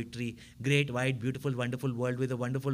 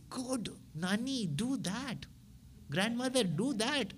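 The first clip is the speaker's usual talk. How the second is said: put-on child pleading; 8 semitones higher, slower than usual. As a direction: coax grandma like a small child. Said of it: "And you will say".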